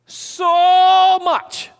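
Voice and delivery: put-on "So" held high and loud like a child; playful imitating child stretching "So"; child's drawn-out so. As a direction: mimic a child's big "so much". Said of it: "your little kids have said this".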